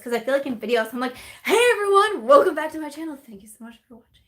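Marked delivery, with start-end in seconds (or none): 1.4-4.2 high, loud channel greeting, tailing off; over-the-top video intro voice; peppy YouTuber intro. Acted. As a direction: parody a gushing YouTuber's welcome. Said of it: "that's how I feel like I look like on YouTube and sound like on YouTube".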